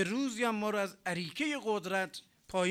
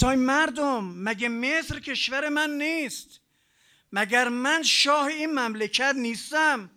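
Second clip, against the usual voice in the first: higher, louder boastful king; shouting Pharaoh; 5 semitones higher, 7 dB louder than usual. Retold or imitated. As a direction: bellow like a proud tyrant to his people. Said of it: "داد زد فرعون".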